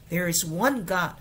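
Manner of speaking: "There is one God" with the weight on "one"; stressed phrase. Shown as a demonstration. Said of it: "这个地方要重读".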